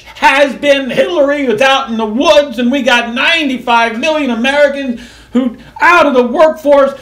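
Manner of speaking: loud, high furious rant; wild unhinged ranting. Real, not acted. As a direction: rant at full steam, outraged. Angry political rant.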